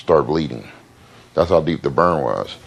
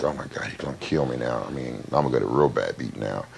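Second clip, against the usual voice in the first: much softer, dread kept to himself; quiet, scared thought about what's coming; hushed retold panic; panicked inner thought; 6 semitones lower, 9 dB quieter than usual. Panicking as dad is called.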